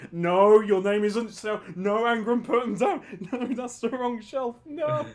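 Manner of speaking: rising, flustered no; panicked telling-off; flustered scolding. Pretend.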